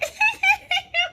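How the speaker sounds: high-pitched loopy giggling; silly squealing laugh; giddy giggle. Retold or imitated. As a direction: imitate a drugged, uncontrollable giggle. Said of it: "But on the outside, I'm like".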